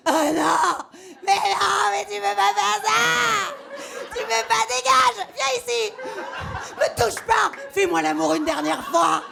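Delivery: high, tearful shouting match with laughs; screaming breakup fight. Pretend. Comedian acting out a breakup screamed in the street, shrill and sobbing. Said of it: "je veux que ça crie dans la rue à trois heures du mat, avec une bonne voix de gorge".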